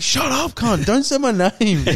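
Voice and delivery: high-pitched retold telling-off; mock outburst. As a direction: cut your friend off, pitched right up. Retold snapping at a friend.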